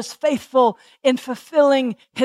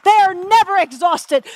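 preaching at full force, higher and ringing; fervent preaching; 4 semitones higher than usual. Acted. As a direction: lift it into a fervent, ringing sermon peak. Preacher builds to fervent peak.

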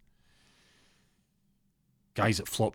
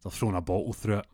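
ordinary talk, nothing marked

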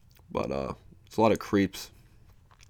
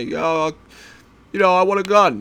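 higher, louder casual dude voice; sarcastic bit about a guy asking for a gun; mock gun buyer; 10 semitones higher, 7 dB louder than usual. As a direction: toss it off like a careless buyer. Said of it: "you know what they say?".

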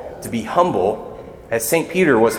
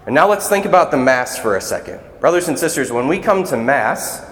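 normal talking voice

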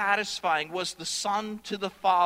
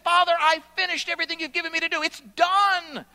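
eager report; 8 semitones higher, 4 dB louder, faster than usual. Acted. Preacher voices Jesus reporting.

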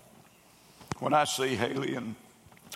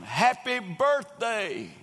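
retold scream; 8 semitones higher, 3 dB louder, much slower than usual. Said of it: "they scream".

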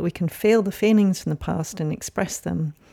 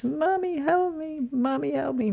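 put-on a frightened baby calling mummy, much higher; child's plea; 10 semitones higher than usual. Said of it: "I said those words".

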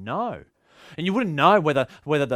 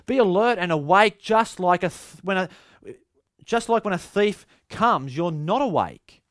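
higher, louder urgent warning; preached voicing of Jesus, raised and loud; Jesus urging; 7 semitones higher, 5 dB louder than usual. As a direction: press them to stay awake. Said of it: "Jesus is saying".

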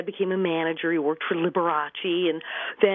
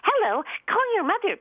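much higher, thin mock voice of her mother; imitating mother's odd voice; 13 semitones higher than usual. Daughter imitating her mother's answering machine voice, high and odd. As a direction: put on her mother's strange cartoon-ish voice. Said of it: "What is that voice?".

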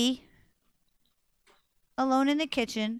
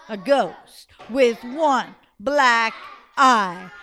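spooky reveal; 5 dB louder, much slower than usual. A narrator's reading. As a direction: draw it out to scare the kids.

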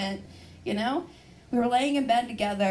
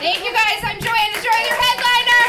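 high, loud farewell to the crowd; shouted sign-off; 10 semitones higher, 10 dB louder than usual. Real, not acted. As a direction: call it out, rising in force. Signs off to cheering audience.

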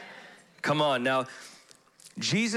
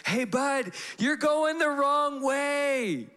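calling out a warning; 13 semitones higher, slower than usual. Retold or imitated. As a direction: yell it across the road.

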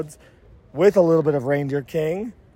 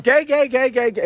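mock scream; 7 semitones higher than usual. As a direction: playfully scream it out like you're at work. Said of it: "if I were at work and I just screamed".

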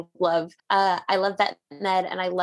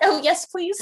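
eager yes; 9 semitones higher, slower than usual. Retold or imitated. Eager reply to puppy owner.

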